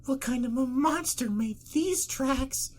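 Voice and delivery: high, drawn-out frightened voice; panicked. A narrator's reading. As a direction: stretch the question out in high-pitched dread. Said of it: "couldn't keep the panic out".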